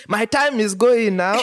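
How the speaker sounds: high, slow, fussy complaint; fussy woman. Retold or imitated. Impatient mother complaining amid the chaos, high and slow.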